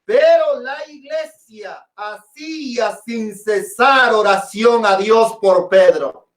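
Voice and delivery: pero la iglesia hacía sin cesar, stretched out very slowly; slow drawn-out repeat. Shown as a demonstration. Preacher repeating the verse so the congregation takes note, slow and drawn out. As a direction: draw each word of pero la iglesia out for weight. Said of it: "noten esto".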